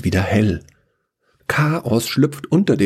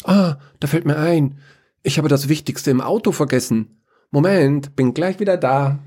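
higher, quick and flustered; suddenly remember, then rush off; scatterbrained Chaos; 8 semitones higher than usual. Acted. Chaos forgot something in the car, flustered.

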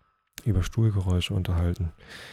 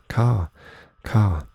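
mild call; 2 semitones higher, 6 dB louder than usual. A narrator's reading. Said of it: "Dann aber ertönte es wieder".

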